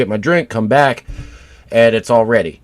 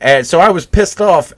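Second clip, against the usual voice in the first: normal talking voice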